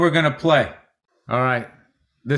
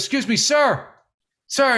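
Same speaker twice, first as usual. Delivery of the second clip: higher-pitched, lightly voiced polite kid; mock polite kid; 6 semitones higher than usual. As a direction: imitate a kid politely flagging down a stranger. Retells kid approaching a stranger.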